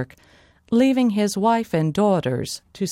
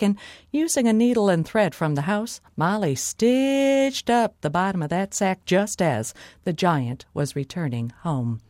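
ordinary delivery

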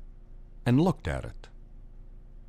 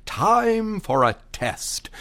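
higher, slow announcing voice; fairy godmother; 9 semitones higher, slower than usual. A narrator's reading. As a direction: announce the test grandly. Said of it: "the fairy godmother said".

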